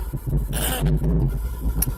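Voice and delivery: a long audible yawn. Real, not acted. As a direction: yawn out loud. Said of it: "this is just me yawning".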